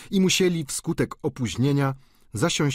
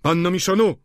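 louder, higher call across the table; 8 semitones higher, 7 dB louder than usual. Acted. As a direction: hail the old maid. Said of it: "zawołał".

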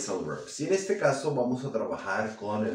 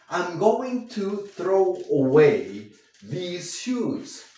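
very slow, spaced-out example sentence; slow dictation; 5 semitones higher, much slower than usual. Shown as a demonstration. Teacher dictating the sentence slowly for students. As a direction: dictate it word by word.

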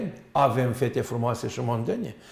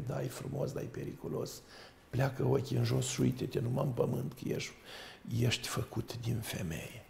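put-on whispered, grave advice to the boy; hushed warning; 10 dB quieter than usual. Old monk whispering a warning to his young disciple. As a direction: drop to a secret warning.

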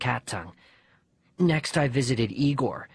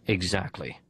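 dry dad; 5 semitones lower than usual. Retold or imitated. Boy quotes Dad refusing the tarantula, low deadpan dad voice. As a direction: drop down into Dad's flat reply.

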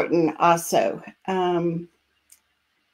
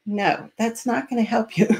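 ordinary talk

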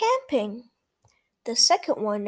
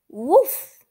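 loud high yappy puppy woof; puppy barks; 3 semitones higher, 7 dB louder than usual. Acted. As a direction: bark like an eager little puppy. Voicing the puppy Biscuit.

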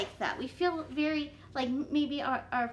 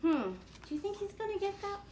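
plain reading voice

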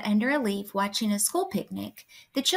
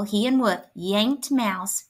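ordinary delivery

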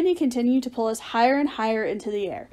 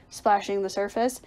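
plain reading voice

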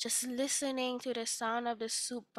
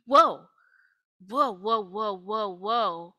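loud amazed whoas, fading down; 9 dB louder, slower than usual. Acted. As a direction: gasp out each whoa in delight. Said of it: "and he says".

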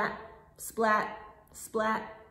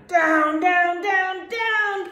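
higher and louder each down; dramatic calling; 7 semitones higher, 9 dB louder, slower than usual. Acted. Teacher voicing the kite plunging down. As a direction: chant it as the kite falls.

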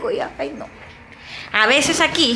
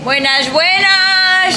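slow, higher and louder greeting; greeting call; 8 semitones higher, 5 dB louder, much slower than usual. Real, not acted. Teacher greeting her friend at school, calling out. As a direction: sing out the greeting.

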